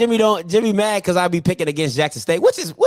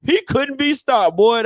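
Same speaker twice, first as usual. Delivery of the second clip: old-head impression; 3 semitones higher than usual. Old heads bragging about a past player, mocking impression. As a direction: brag on a long-gone player like an old head. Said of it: "that's what y'all old heads sound like".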